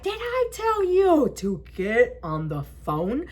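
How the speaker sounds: slow, deliberate scolding question; drawn-out reprimand; imitated stern officer. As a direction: spell it out like a cop telling someone off.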